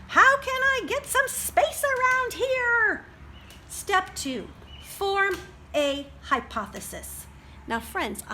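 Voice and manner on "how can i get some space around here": put-on high, loud exasperated girl voice; a fed-up kid shouting for room; frustrated girl. Charlotte to her crowded family, high exasperated cry. Child character complains.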